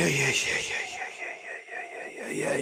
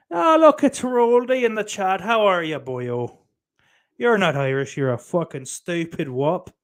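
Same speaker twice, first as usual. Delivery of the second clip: greeting a chatter in a hammed-up Irish accent, higher; mock Irish accent; 6 semitones higher, 5 dB louder than usual. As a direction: lay on a playful fake Irish lilt. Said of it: "But I'm still gonna use the accent".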